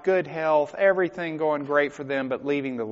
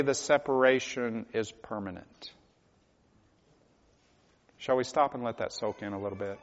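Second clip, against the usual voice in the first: ordinary delivery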